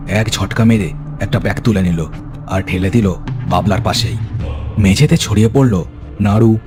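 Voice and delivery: ordinary delivery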